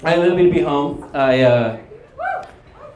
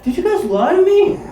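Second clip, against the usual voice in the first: much higher, rising question; mock hurt disbelief; 13 semitones higher than usual. Duped teacher to her class, high mock disbelief. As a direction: squeak it upward as an imitated accusation. Acting teacher realizing she was tricked.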